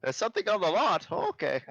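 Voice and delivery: put-on high, fast mishearing old woman; hard of hearing granny. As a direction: mishear it like a deaf old nan.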